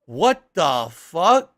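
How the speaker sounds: loud, slow, drawn-out disbelief; stunned what the fuck. Retold or imitated. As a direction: stretch it out, floored by the ad. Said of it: "And I was like".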